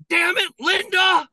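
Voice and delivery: imitated exasperated shout. Responder hearing Linda's address at night, imitated exasperated shout. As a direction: shout it like a fed-up responder woken up. Voicing crew cursing repeat caller.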